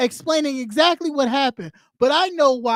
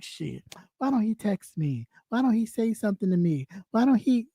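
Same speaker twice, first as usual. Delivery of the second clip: low, much softer mock fretting; mock girlfriend second-guessing; 4 semitones lower, 6 dB quieter than usual. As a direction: fret it to yourself like a dumped girlfriend. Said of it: "like a girlfriend that broke up".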